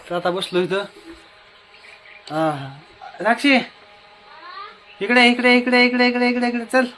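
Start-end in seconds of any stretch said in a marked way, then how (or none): 3.2-6.9 high, insistent repeated call; calling over. Real, not acted. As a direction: call someone over on one raised note. (वरच्या आवाजात सारखी हाक मारत)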